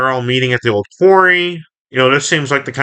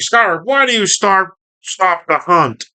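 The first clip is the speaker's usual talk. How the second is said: Starscream; 7 semitones higher than usual. Retold or imitated. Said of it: "Scarp's like".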